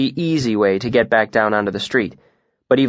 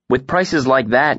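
ordinary delivery, nothing marked

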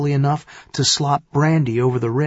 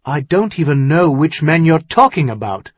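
louder, higher protest of innocence; 4 semitones higher, 6 dB louder than usual. Acted. Accused son-in-law denies knowing the men, protesting. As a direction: object with raised voice. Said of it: "Tyrion protested".

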